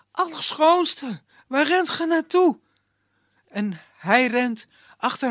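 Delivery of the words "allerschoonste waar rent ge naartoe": calling out. Acted. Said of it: "Iskander die roept".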